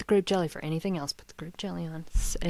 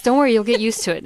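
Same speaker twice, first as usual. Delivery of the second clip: higher and louder, bright reassurance; reassuring chef; 5 semitones higher, 9 dB louder, faster than usual. Retold or imitated. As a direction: call it out like a cheery host.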